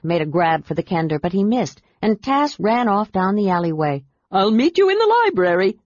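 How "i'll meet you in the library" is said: higher, louder call while running off; calling over his shoulder. A narrator's reading. Kender calling back to friends while running off. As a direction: shout back as he runs away. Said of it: "Tass called over his shoulder".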